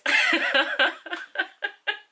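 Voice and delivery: high giggle after greeting; a short bright giggle. Real, not acted. Nervous laugh after greeting.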